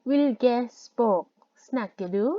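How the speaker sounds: Vil ge språk snakker du said word by word, very slowly; very slow demo. Shown as a demonstration. Teacher slowly models a Norwegian question for students, very slow and clear. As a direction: spell out each Norwegian word for learners. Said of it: "คุณพูดภาษา ภาษาอะไรได้บ้าง".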